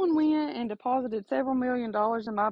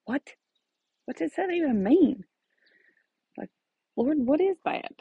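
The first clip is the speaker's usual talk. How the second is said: puzzled surprise; 8 semitones higher than usual. Retold or imitated. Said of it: "and you're like".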